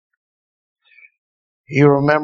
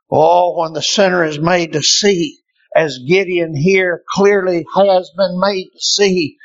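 ordinary talk, nothing marked

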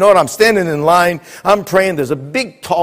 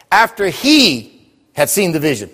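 slow, with "he" lifted and held out; stress on "he"; slower than usual. Shown as a demonstration. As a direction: lean hard on the word "he". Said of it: "Did you see the change?".

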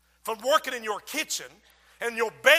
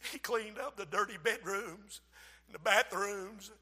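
ordinary talk

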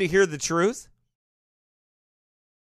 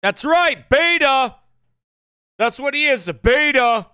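higher, louder taunting beta; mocking shout; 6 semitones higher, 3 dB louder than usual. Real, not acted. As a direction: jeer the word beta at him. Mocking the man in clip.

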